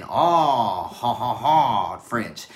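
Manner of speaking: hammed-up French "oh, ha, ha, ha"; a cartoon Frenchman's chortle, played for fun; mock French laugh. Mock French laugh after ad list.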